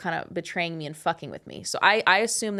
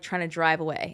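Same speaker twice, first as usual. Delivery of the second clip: ordinary talk, nothing marked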